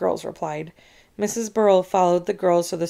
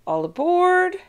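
high, loud call, a little less force; 12 semitones higher, 5 dB louder, slower than usual. A narrator's reading. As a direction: call it again down the line. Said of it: "He kept calling".

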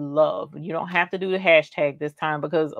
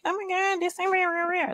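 imitating a gushing customer, higher; a light, higher mock gasp of disbelief; mock amazement; 12 semitones higher, 4 dB quieter than usual. Customers seeing the bling, mock amazed gush. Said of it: "they're like".

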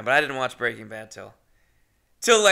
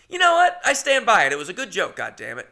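defiant; 7 semitones higher, 10 dB louder than usual. Real, not acted.

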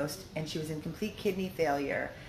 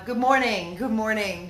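ordinary talk, nothing marked

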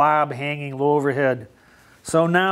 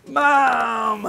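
excited shout; 8 semitones higher than usual. Acted. Child calls out in story.

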